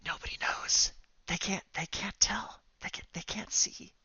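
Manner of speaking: hushed, breath-only confiding; secretive whisper. Acted. Jean-Luc leans over to Ambrose, whispering secretively.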